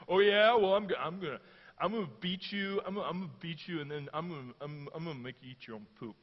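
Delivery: playground bragging, mock childish; a mild hint of a second grader picking a fight; lightly voiced bratty kid boasting; schoolkid taunt. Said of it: "Kinda sounds like a second or third grade kid having a fight".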